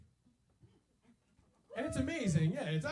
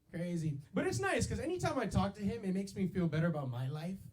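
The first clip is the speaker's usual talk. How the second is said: ordinary talk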